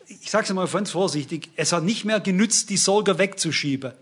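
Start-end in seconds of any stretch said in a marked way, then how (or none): none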